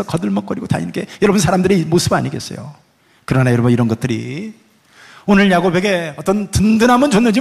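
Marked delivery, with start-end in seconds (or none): none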